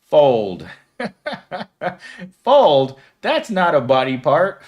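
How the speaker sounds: loud, incredulous Fold?; surprised. Real, not acted. Streamer shocked by a viewer's answer, loud disbelief.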